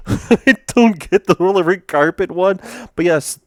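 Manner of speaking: high, loud, through a laugh; laughter bubbling into the words; amused laughing. Real, not acted. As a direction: confess confusion while giggling. Laughs at the puzzling meme line.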